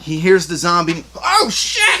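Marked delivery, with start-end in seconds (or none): 1.2-2.0 high startled yelp with a laugh. Retold or imitated. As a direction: jump in fright, laughing. Startled character yelps.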